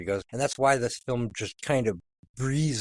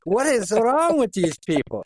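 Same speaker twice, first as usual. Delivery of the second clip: put-on cranky old Scotsman, Scottish accent; 4 semitones higher than usual. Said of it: "Worst, worst Scottish accent ever".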